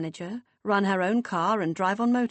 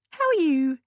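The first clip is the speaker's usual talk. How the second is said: high, louder sing-song sister voice; 9 semitones higher, 6 dB louder than usual. A narrator's reading. Beth chirping down the phone in a high sing-song.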